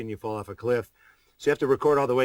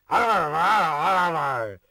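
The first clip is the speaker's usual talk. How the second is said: slow, loud, high mock growl of squabbling lawyers; 7 semitones higher, 6 dB louder, much slower than usual. Star mocks lawyers for three franchises squabbling, slow mock snarl. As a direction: drag out a snarling arr arr like bickering lawyers. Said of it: "three batches of lawyers going".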